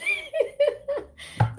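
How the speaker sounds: high squealing burst of laughter. Real, not acted. Tarot reader caught by a viewer's comment, squealing laugh.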